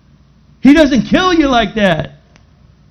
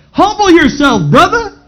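higher and louder, calling out; urgent exhortation; 8 semitones higher, 4 dB louder than usual. Real, not acted. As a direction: plead with the brother to humble himself. Preacher exhorts congregation.

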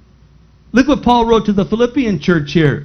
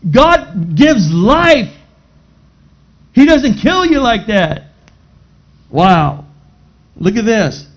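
ordinary talk, nothing marked

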